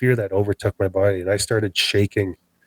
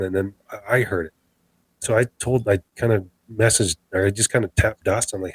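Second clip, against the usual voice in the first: ordinary delivery, nothing marked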